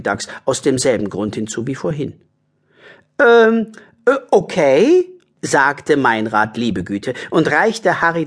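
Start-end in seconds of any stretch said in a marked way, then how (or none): 3.2-5.1 high, drawn-out hesitation; stall, then agree in a high voice; hesitant character voice. Acted. Meinrad agrees to lend his umbrella, high hesitant voice. Reluctant passer-by lends umbrella.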